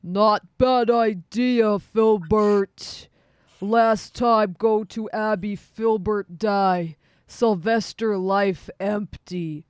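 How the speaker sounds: loud, slow lumbering creature voice; brain-patched creature. Acted. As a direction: boom it out ponderously in broken English. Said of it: "this one is like".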